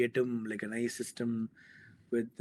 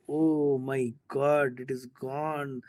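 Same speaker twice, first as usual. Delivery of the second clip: mock despair; 4 dB louder, much slower than usual. Panicking as critic writes notes.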